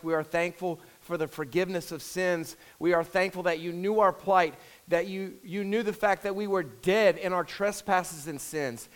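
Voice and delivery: earnest congregational prayer, measured. Acted. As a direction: pray aloud for the whole church.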